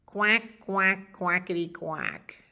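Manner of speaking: loud quacks, easing off; duck quacking. Acted.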